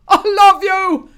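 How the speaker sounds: high, loud, slow wailing sob; hammed-up sob of love; sobbing. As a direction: cry it out desperately. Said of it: "Fruzzy sobbed".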